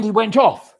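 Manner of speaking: ordinary delivery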